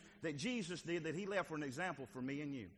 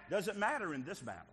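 ordinary talk, nothing marked

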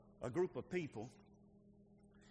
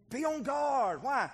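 calling out; 9 semitones higher, 7 dB louder, slower than usual. Real, not acted. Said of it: "preacher calls church awake".

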